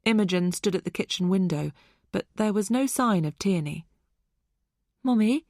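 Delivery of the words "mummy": put-on high, questioning little-girl voice; lightly voiced child calling for mum. A narrator's reading. Daughter seeking her mother's attention, childlike. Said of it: "It was Rachel".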